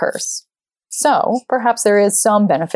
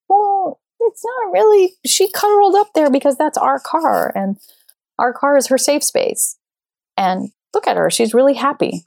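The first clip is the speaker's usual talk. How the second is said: high, eager self-justifying explanation; retelling her own flustered defence, much higher; defensive explaining; 7 semitones higher than usual. Retold or imitated. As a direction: plead your case, pitched way up.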